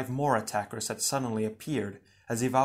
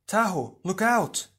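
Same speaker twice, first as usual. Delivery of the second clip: higher, urgent call to look out; shouted warning; 9 semitones higher, 4 dB louder than usual. Acted. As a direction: call a sudden warning in battle. Warning shout amid an attack.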